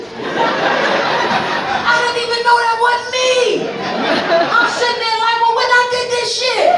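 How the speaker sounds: loud, slow, high laughing yell; shouting old man amazed. Retold or imitated. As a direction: blow up in disbelief. Dad's amazed reaction voiced.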